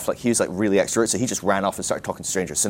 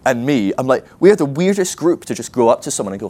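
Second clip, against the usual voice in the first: higher, louder worried rush of thought; mild retold panic; 5 semitones higher, 5 dB louder than usual. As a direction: recount it with a touch of panic. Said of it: "I was just in this panic state".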